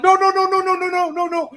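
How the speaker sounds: high, loud string of panicked nos; frantic mock no. TI runs up trying to stop the female TI, high frantic nos. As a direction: try to stop it in a rush. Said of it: "He's like".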